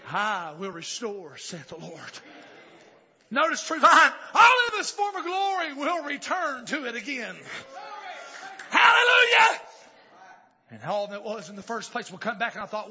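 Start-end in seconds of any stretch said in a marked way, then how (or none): 3.3-9.6 high, loud proclamation capped by a shout; exultant preaching. Real, not acted. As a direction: ring it out, then shout the praise. Shouted proclamation ending in hallelujah.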